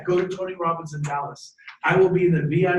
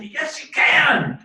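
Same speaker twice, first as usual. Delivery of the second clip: high, loud, drawn-out cheer; rally a doubting kid at full voice; booming pep-talk rebuttal; emphatic encouragement; 8 semitones higher, 6 dB louder, much slower than usual. Acted. Parent answers a doubting teenager.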